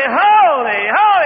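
high, loud ringing holy; ecstatic angel cry. Acted. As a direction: cry it out in awe. Creatures crying out again.

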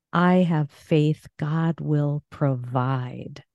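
"provide" stretched at the end; stress on PROVIDE. Shown as a demonstration. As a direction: slowly land on "provide". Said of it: "here's a positive faith affirmation".

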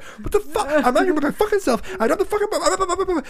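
high-pitched ranting, tumbling into blahs; retold freak-out. Hurt dad protesting to his ex that he was by himself, freaking out. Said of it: "I'm still, like, freaking out".

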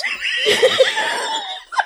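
high burst of real laughter; a shrieking laugh breaking the read. Real, not acted. Cracks up mid-reading.

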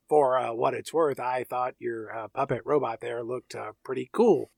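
low, soft hesitant mumble; timid assistant. Retold or imitated. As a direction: say it shyly like a meek sidekick. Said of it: "he goes".

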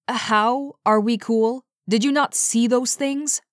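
incredulous, pitch climbing on the second question; disbelief. A narrator's reading. Dante gaping at her after seeing those things, incredulous. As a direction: challenge her in mild, rising disbelief. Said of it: "Dante gaped at her in disbelief".

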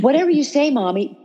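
sing-song it sweetly to defuse her; sweet placating. Retold or imitated. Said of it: "I came up with a phrase".

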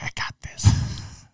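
whispered, slow boast. Retold or imitated. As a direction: breathe it out smugly. Imitating some confident guy.

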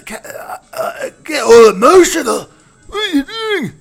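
high, loud mock Glaswegian doll; Glaswegian accent. Host mocking the robot doll in a Glaswegian accent. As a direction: ham up a Glaswegian sex doll getting emotional. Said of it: "a doll with a Glaswegian accent".

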